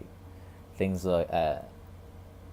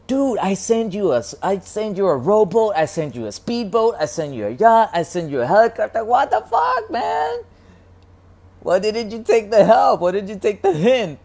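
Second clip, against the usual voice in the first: much higher, much louder exasperated rant; exasperated God yelling; 10 dB louder than usual. Retold or imitated. God yelling at the man who refused every rescue. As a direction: rant like a fed-up buddy who tried everything. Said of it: "And God's like".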